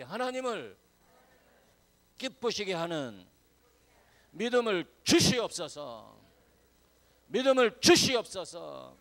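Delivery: slow, very loud congregational prayer call, building in force; loud prayer cry. Real, not acted. Preacher leading everyone together in prayer for faith, loud and fervent. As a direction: cry it out phrase by phrase for all to follow.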